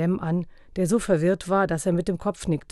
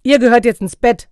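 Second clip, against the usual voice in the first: loud, higher-pitched command; stern order; 6 semitones higher, 12 dB louder than usual. A narrator's reading. Father sending the children to bed, loud and stern.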